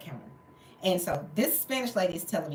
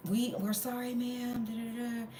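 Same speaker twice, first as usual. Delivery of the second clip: higher, softer imitated staff apology; mock apology; 5 semitones higher, 7 dB quieter than usual. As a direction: mimic a rote customer-service sorry.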